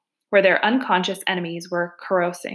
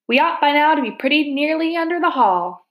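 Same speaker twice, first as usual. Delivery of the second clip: lightly voiced Badger, pitched up; raised-pitch character voice for the Badger; Badger's voice; 7 semitones higher than usual. Badger tells his friends they are under the hall, lightly voiced Badger.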